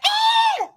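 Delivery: a sudden very high shriek of surprise; excited shriek. Retold or imitated. Retelling her reaction at lunch with her sister, an excited shriek. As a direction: squeal at spotting the picture. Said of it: "And I was like".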